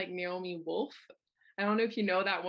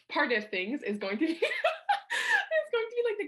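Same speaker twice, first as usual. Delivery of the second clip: laughing through the words, flustered; laughing at herself; 5 dB louder than usual. Real, not acted. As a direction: giggle through a stumble. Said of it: "oh my God".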